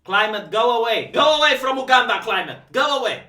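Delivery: mock yelling at the weather to leave Uganda; mock command. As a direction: order the climate away like a pest, in mock outrage. Mock shouting at the climate.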